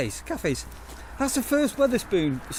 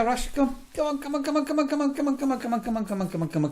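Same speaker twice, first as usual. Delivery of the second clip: high, coaxing sing-song call; calling the dog; 7 semitones higher than usual. Real, not acted. Owner calls the dog Russia over, high and coaxing. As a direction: coax the dog over eagerly.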